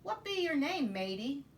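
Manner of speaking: high, loud pirate question. Acted. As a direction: ask like a friendly pirate. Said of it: "the head pirate asked".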